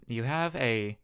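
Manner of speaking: usual speaking voice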